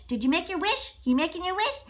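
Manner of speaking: ordinary delivery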